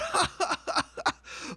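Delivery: high, rapid staccato laugh; mock Alexa laugh. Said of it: "she just bursts out".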